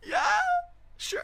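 high, squeaking mock agreement; squeaky voice. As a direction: let the voice squeak in imitation. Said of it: "Just voice squeaking, like".

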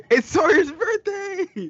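high, slow, gleeful birthday cheer; excited shout. Acted. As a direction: announce it like a party host.

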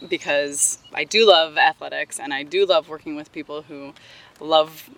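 ordinary talk, nothing marked

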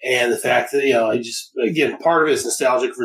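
ordinary talk, nothing marked

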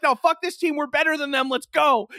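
high, loud, defiant pep talk; rally the team against the odds; pumped-up battle cry; fired-up rallying cry. Retold or imitated.

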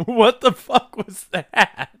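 high, loud, slow mock outrage; cackling disbelief. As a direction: shout it out through laughter. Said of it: "everybody in the background is probably cackling".

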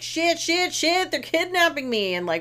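high, loud, rushed comic fretting; mock panic. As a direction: panic theatrically as if being carried off. Retold kidnapped heroine's panicked thoughts.

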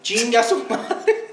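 laughing, slow gleeful curse. Real, not acted. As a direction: savor it through a laugh. Gleeful punchline. (riendo con gusto)

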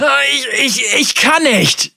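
high, stammering terrified plea; terrified horror victim. A narrator's reading. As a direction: stammer in pain and fear. Devaki in pain.

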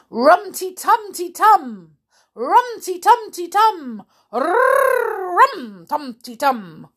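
high, loud drumbeat syllables with rolled R; loud drum imitation. Amelia bangs the drum for help, loud drumming noise. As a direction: bang it out like a toy drum. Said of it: "banged hard on that little drum".

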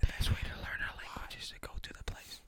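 hushed unvoiced whisper. Real, not acted. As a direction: whisper it under your breath. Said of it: "You guys are all whispering".

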